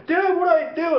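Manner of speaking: put-on high-pitched worried kid; mock child distress. Kid with a cut asking dad, mock distress. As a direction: whine for help like a child, acted.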